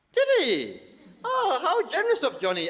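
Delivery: high mock-grateful sarcasm; sarcastic. Real, not acted. Comic sneering at his nephew's offer, sarcastic. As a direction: drip fake gratitude.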